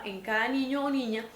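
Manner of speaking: ordinary talk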